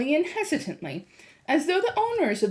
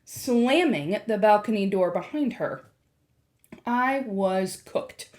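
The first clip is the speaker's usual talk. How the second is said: plain reading voice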